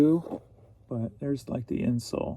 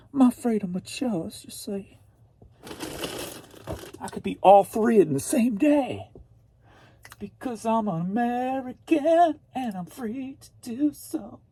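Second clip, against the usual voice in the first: mock grand; 9 semitones higher than usual. Mock patriotic declaration.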